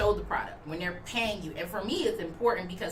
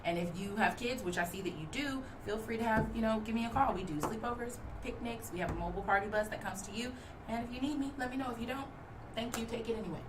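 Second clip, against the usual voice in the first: soft quick pitch; 7 dB quieter than usual. Shown as a demonstration. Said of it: "I'd be like".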